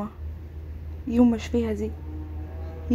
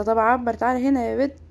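ordinary talk

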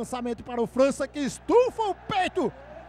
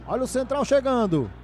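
usual speaking voice

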